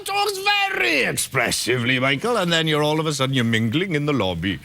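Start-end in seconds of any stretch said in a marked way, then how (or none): 0.1-2.0 father impression. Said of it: "talking like my father".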